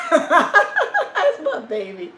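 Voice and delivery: high, slow, laughing coo; laughing fondly. Real, not acted. Owner laughing with her dog, high fond coo. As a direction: laugh it out with love.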